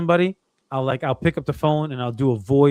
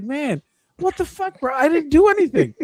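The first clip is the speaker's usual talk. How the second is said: mock rant; 12 semitones higher than usual. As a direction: vent at the scam caller, half laughing. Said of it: "I lost my shit".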